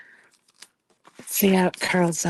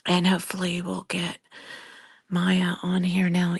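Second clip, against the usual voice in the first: usual speaking voice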